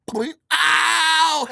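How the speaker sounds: a high loud sudden ow; pained yelp. Retold or imitated. Pulling bark out of his cut, high loud pained yelp. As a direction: yelp as the splinter comes out.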